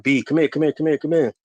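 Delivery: retelling the woman calling from the hallway, urgent and high. Retold or imitated. Lady calling him urgently.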